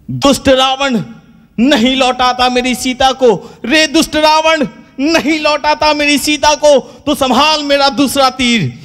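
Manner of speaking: high, slow, drawn-out declamation; stagey heroic shout. Acted. Ram firing his second arrow, slowly declaiming at Ravan. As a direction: stretch every word like a stage hero. Ram repeats challenge, louder.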